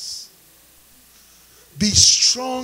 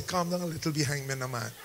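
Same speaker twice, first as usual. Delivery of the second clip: hushed aside; 6 semitones lower, 12 dB quieter, faster than usual. Retold or imitated. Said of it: "touch Pastor Don and say".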